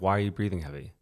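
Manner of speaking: low, soft, unaggressive question. Shown as a demonstration. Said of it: "I'm just saying".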